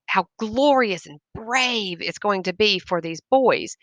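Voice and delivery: usual speaking voice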